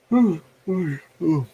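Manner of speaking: stretched, sleepy yawn noise; yawning ooh. Real, not acted. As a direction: let a real yawn carry the ooh. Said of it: "why am I yawning".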